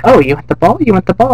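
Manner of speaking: lightly voiced mom baiting a pet; teasing dog talk. Lois teasing the dog Brian with the ball. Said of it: "And she's like".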